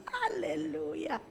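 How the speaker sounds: ordinary talk, nothing marked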